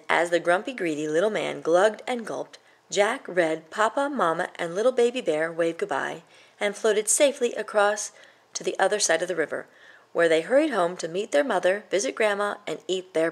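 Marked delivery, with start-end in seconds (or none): none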